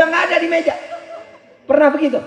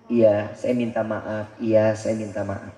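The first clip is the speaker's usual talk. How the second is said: lower, softer, slow humble apology; 6 dB quieter, slower than usual. Retold or imitated. A wife calming her angry husband, softly and slowly apologizing. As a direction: say sorry meekly and gently.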